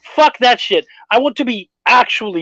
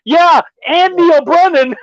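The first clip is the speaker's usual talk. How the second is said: higher, drawn-out mock yell; retold heckling yell; 7 semitones higher, slower than usual. Comic retells Chris Rock heckling a speech, mock yell. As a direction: call it out slowly, imitating a heckler. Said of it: "Chris Rock fucking yells out".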